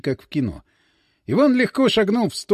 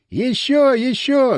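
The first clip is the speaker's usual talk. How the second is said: crowd yelling; 7 semitones higher, much slower than usual. A narrator's reading. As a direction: shout it like an eager crowd. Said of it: "заорала толпа".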